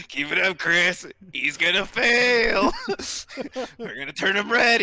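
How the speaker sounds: loud, high mischievous goading; gleeful egging on. Acted. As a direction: cheer on the teasing, laughing. Gleeful voice in player's head.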